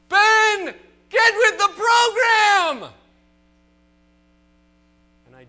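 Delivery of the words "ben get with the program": full-throated shout, much higher and louder, slow and drawn out; coach's yell. Retold or imitated. Coach yelling at a daydreaming boy in right field, shouting. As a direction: bellow it across the field like an angry coach. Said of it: "He yelled at the top of his lungs".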